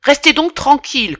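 indignant teacher restoring order. Acted. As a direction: scold them into silence. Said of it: "le professeur indigné".

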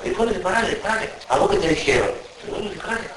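high squeaky imitation of the robber; mock high robber voice. Robber telling the gunman to stop, in a high mock voice.

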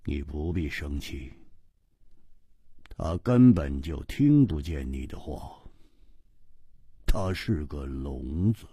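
soft, low, unconcerned; calm stranger in the carriage; cool and offhand. Acted. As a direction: say it coolly, barely bothering. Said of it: "淡淡的说".

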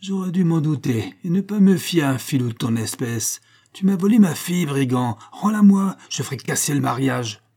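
outraged tirade with high, loud bursts; exasperated. A narrator's reading. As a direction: rage at the thieving son-in-law. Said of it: "hurla Toinose exaspéré". (tirade indignée, éclats aigus et forts)

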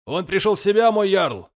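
high shout to the jarl; calling out. Acted. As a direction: call it across the deck. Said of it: "крикнул Лирак".

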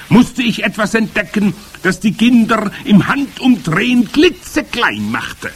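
high, loud witch voice; commanding Grand High Witch. A narrator's reading. Witch leader starting to explain her recipe, commanding.

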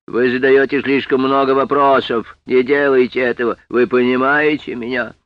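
loud, hard threat; stern warning. Acted. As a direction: warn him, loud and cold. Policeman warns Sam.

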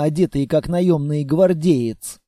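ordinary delivery, nothing marked

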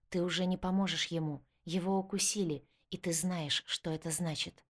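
plain reading voice

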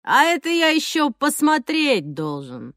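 slow, sneering street thug; menacing thug voice. Acted. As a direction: drawl it like a yard bully picking a fight. Thug Zhokh taunting.

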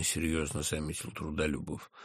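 ordinary delivery, nothing marked

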